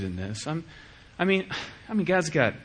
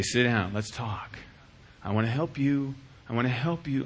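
gentle father; 5 semitones lower, 4 dB quieter than usual. Retold or imitated. Kind father voiced.

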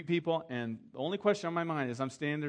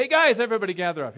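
loud, higher, fast call to gather; 6 semitones higher, 12 dB louder, faster than usual. Retold or imitated. Pastor takes over the rehearsal and calls everyone in, loud. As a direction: grab the room's attention, fast.

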